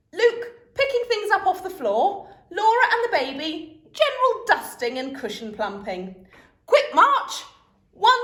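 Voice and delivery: high, loud quick-fire orders; march them off like troops; drill sergeant. A narrator's reading. Dad assigning chores, mock army orders.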